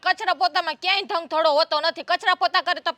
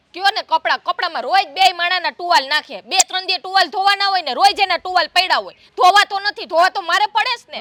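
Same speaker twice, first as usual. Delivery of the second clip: louder, rising, fed-up scolding; angry complaint; 6 dB louder than usual. Acted. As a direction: vent the grievance hard and loud. Sister-in-law complains about towels.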